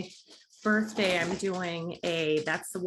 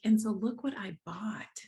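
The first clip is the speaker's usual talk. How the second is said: whispered, like sharing a secret. Real, not acted. Whispering to viewers about her birthday keto cake. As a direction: lean in and whisper the reveal.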